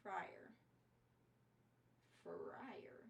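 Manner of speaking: breathy whispered word. Pretend. Streamer whispering fryer to chat. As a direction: mouth it in a whisper.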